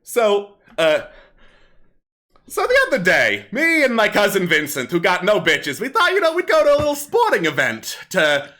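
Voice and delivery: bawdy kobold comic on stage; kobold comedian. Acted. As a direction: perform it like a street comic working a crowd. Kobold comic resumes his act.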